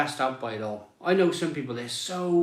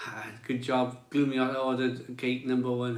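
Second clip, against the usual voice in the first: ordinary delivery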